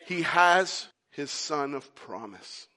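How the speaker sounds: ordinary delivery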